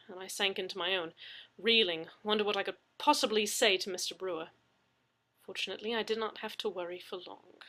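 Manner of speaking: usual speaking voice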